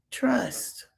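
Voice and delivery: a knowing one-word whisper; whispered aside. Real, not acted. Commentator letting viewers in on a secret, whispered. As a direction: lean in and whisper it.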